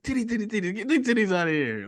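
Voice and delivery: high-pitched comic herding; excited retold order; urgent mock command. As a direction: imitate a character rushing people out. Acting out film character herding crowd.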